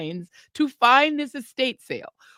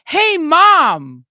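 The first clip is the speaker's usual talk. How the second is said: loud, higher American call to mom; blatantly American accent; 6 semitones higher, 11 dB louder than usual. Retold or imitated. Daughter blows the cover at the sale, loud American accent. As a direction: holler it in broad American. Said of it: "in a totally American accent".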